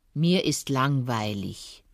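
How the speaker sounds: low, slow grumble; grumbling fox. Acted. Fox admitting boredom to the hare, grumbling. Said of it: "brummte der Fuchs".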